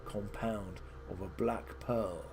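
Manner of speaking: a hint of a wise hermit giving solemn instructions; solemn old wizard advising.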